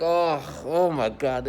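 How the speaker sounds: mock disgusted gasp. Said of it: "you're like".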